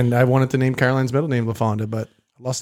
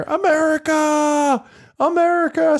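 high, drawn-out mock scream; scream America; 13 semitones higher, slower than usual. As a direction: call out America, high and slow. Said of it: "and I hear".

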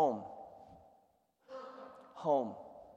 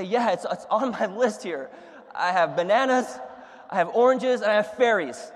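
louder, higher, pushing each item on the list; insistent; 4 semitones higher, 7 dB louder than usual. Retold or imitated. Confused shopper insisting to the grocer, laughing insistence. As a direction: insist on every item, getting more worked up.

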